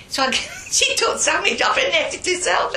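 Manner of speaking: high, loud, laughing through the words; laughing while telling. Real, not acted. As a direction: tell it while cracking up. Breaks up laughing at own story.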